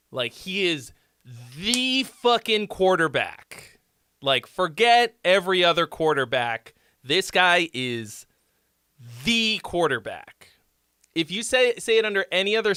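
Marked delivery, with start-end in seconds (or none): 1.6-3.8 THE in "the fucking quarterback" drawn out slow and hit hard; stress on THE. Shown as a demonstration. Coach shows stress on THE, slow and heavy. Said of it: "when would you ever emphasize the word "the". 9.2-10.3 THE in "the quarterback" held and weighted; stress on THE. Shown as a demonstration. Coach shows stress on THE, held and weighted. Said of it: "emphasize the word "the".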